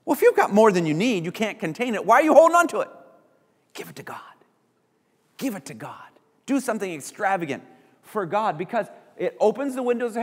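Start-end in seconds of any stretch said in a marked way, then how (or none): 3.7-4.2 soft, low, almost whispered appeal; hushed urging. Real, not acted. Preacher hushes to urge giving. 5.4-5.9 hushed urging. Real, not acted. Preacher repeats the hushed urging.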